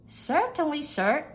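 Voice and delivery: high-pitched maid's reply; high, obliging maid voice. A narrator's reading. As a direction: answer the master high and readily. Maid answers her master.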